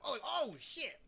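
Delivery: retold shocked cry; startled yelp. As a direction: yelp as a knee hits your back. Knee slammed into his back.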